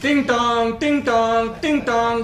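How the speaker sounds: repeated, level ding-dong chimes; imitated doorbell. Husband keeps ringing at closed door, doorbell imitation. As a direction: ring the bell insistently with your voice. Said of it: "मी bell वाजवलीये".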